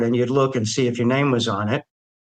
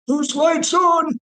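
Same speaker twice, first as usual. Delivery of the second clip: very high, slow, drawn-out call; calling down the hall; much slower than usual. Retold or imitated. Officer down the hall demanding whose lights are on, slow high call. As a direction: bellow it down a long hallway.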